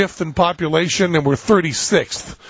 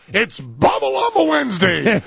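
"Bomb a Llama Wednesday" proclaimed higher, through laughter; laughing announcement; 6 semitones higher than usual. Pretend. Host proclaiming Bomb a Llama Wednesday to listeners, laughing. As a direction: crown the phrase like a holiday name. Mock announcing a silly holiday.

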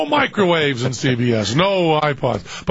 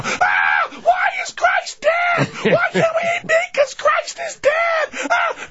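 put-on very high, wailing children's shrieks; screaming kids; slower than usual. Children cry to their parents about meat, screaming. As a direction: wail and scream like kids in a car. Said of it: "with a car full of screaming".

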